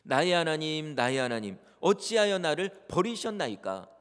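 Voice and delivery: ordinary delivery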